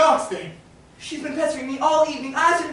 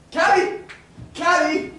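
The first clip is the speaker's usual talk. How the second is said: calling out; 6 semitones higher, 5 dB louder, slower than usual. Acted. Gentleman calls for a cab to escape, shouting. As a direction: call for a cab, louder and higher. Hailing a carriage.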